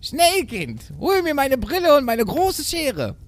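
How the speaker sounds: high, drawn-out theatrical command; goat mother calling. A narrator's reading. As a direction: send the kid off in mother-goat voice. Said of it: "rief die Geißenmutter". (hoch rufende Geißenmutter)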